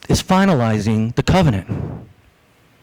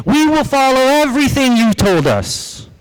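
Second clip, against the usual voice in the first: raised, eager chorus of the crowd; the people's eager promise; 6 semitones higher than usual. Acted. The people pledging to God, raised eager crowd voice. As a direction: promise it like the whole crowd at once.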